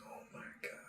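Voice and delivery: hushed, voiceless gasp of words; awed whisper. Acted. Blacksmith opens a sack of gold, awed whisper. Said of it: "he goes".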